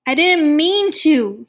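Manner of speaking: loud, high anguished blurt. A narrator's reading. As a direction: burst out with it, pleading. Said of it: "he finally blurted out".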